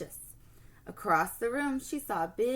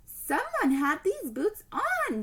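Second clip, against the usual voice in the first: huffing; 10 semitones higher, 4 dB louder, slower than usual. Acted. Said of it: "she huffed".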